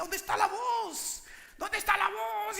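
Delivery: put-on child's voice. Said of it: "Y yo decía".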